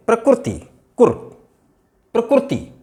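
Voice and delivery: प्रकृति said as prakruti with रु; Gujarati-style pronunciation. Shown as a demonstration. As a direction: say प्रकृति with रु instead of ऋ. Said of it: "प्रकृति को वे प्रकृति बोलते हैं". (प्रकृति को 'प्रकुरति' की तरह बोलें)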